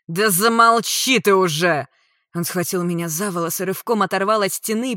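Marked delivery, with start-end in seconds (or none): 0.1-1.9 loud, slow, forceful command; angry order. A narrator's reading. Attacker to screaming woman, angry. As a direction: shut the victim up with force.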